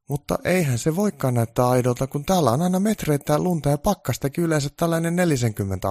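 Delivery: lightly voiced naive helper; helper thinking aloud. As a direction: blurt the obvious. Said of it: "Onni erehtyi ajattelemaan ääneen".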